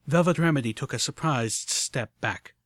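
usual speaking voice

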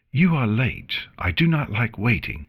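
a touch of stern impatience; sternly. A narrator's reading. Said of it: "she said sternly".

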